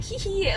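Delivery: soft, high mock giggle; a quiet conspiratorial hee hee; sneaky giggle. Pranksters gloat over fooling their friend, soft high giggle. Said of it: "Fahim and I are like".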